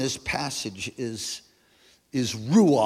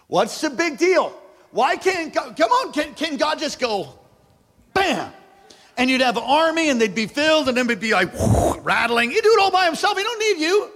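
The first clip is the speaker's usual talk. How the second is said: high, loud shouted preaching; rally the congregation, full voice; preaching at full force; 11 semitones higher, 6 dB louder than usual. Acted. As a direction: pastor building to a shouting climax.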